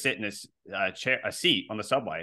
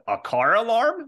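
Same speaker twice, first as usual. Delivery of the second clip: put-on confused old man; 10 semitones higher, 5 dB louder, slower than usual. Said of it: "he turns to me and says".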